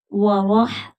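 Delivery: ordinary delivery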